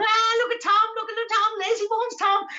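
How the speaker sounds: very high squealed demon taunt; high-pitched goblin jeering; little demon. Acted. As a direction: screech it like a mocking imp. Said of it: "like little demons".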